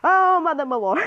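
high, loud exclamation; alarmed outburst. Real, not acted. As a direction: yelp it out in alarm. Alarmed outburst during the game.

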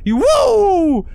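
loud high cheering whoop. Real, not acted. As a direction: whoop in joy.

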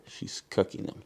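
soft unvoiced whisper, pointing her out; whispered aside. Real, not acted. Child showing viewers a cook, whispering. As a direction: whisper it so the cook won't hear.

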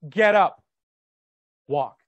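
loud, stern command to rise. Retold or imitated. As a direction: order him up with authority. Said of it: "He looks at him sternly and goes".

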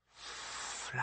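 soft, breathy, ghostly whisper. Acted. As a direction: breathe it like the sea itself. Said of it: "spoke the shell in its breathy voice".

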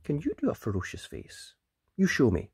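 plain reading voice

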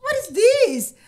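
high, loud laughing exclamation; reliving the takeoff with a laughing cry; thrilled cry. Retold or imitated. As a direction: cry out in giddy wonder.

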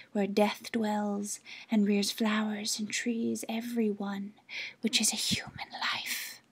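hushed whispering lake spirit. Acted. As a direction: coax her in a secret whisper. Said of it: "said the lake".

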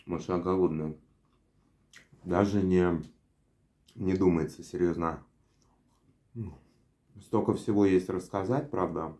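biting fries mid-sentence; chewing while talking. Real, not acted. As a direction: mouth partly full of fries. Seen 0: Fries handled throughout; bites fries at mouth from 4.0 while speaking.

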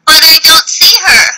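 searching for the teacher; searching, eager. Shown as a demonstration. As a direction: act out looking eagerly. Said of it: "I wanted you to see".

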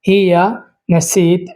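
Hiya নাসিত stretched slowly, every sound clear; slow teacher's repeat of Hiya নাসিত; slow model pronunciation. Shown as a demonstration. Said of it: "তো প্রথম শব্দ হচ্ছে নাসিত".

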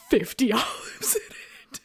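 words drawn out through laughter; cracking up. Real, not acted. Podcaster describing the overfilled martini glass, cracking up. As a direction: slowly dissolve into helpless laughter.

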